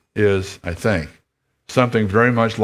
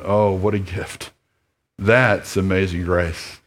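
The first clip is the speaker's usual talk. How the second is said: earnest, measured pastoral prayer. Real, not acted. Pastor praying for the congregation, earnest and measured. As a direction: speak to God on behalf of the church.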